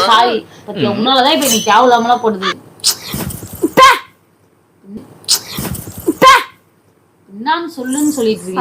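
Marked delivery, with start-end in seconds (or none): 3.8-3.9 sudden high, loud boo; jump-scare them with a short burst; playful scare. Real, not acted. Startling the other person with boo. 6.2-6.3 sudden high, loud boo; jump-scare them with a short burst; playful scare. Real, not acted. Startling the other person with boo.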